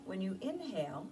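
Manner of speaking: hushed calming guidance; guided meditation. Acted. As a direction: guide them into stillness, gently. Leads a meditation.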